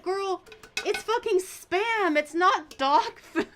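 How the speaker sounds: put-on high, loud, slow "Girl, it's fucking Spam"; laughing disbelief at the absurdity; exasperated. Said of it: "And I'm like".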